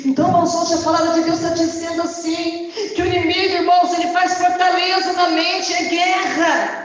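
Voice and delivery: high, loud fiery preaching with shouts; impassioned shouted sermon building to a roar; preaching at full force. Acted. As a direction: thunder it out over the congregation like a revival preacher. Preaching climax to the church.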